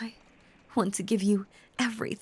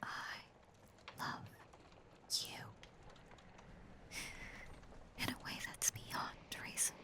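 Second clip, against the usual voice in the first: very soft, halting whisper; whispered love confession; 6 semitones higher, 14 dB quieter than usual. Acted. Obsessed lover tells the beloved they must love back, whispered.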